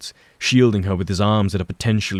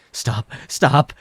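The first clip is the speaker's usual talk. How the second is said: begging; 6 semitones higher, 4 dB louder than usual. A narrator's reading. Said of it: "he begs".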